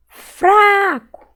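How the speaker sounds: "Fraco" with a loud, high, long FRA; stretched stressed syllable. Shown as a demonstration. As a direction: call out "Fraco", holding FRA. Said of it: "Qual que eu demorei mais falar".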